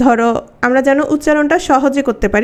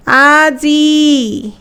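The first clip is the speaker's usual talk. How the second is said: আজি drawn out long and louder; stressed আজি; 4 dB louder, much slower than usual. Shown as a demonstration. Teacher stretching আজি to point at the final vowel. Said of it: "দেখো".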